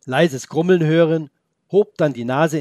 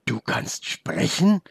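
put-on slow, wondering boy's question; can't believe the wolf talks; astonished; 3 semitones higher, slower than usual. Boy amazed that the wolf can talk, slow wonder. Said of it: "fragte Felix den Wolf erstaunt".

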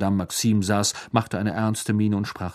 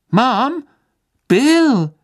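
startled, much higher and louder cry; astonished; 9 dB louder than usual. A narrator's reading. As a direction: blurt out both names in disbelief. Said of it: "vollkommen verdutzt". (völlig verdutzt, hoch und laut ausgerufen)

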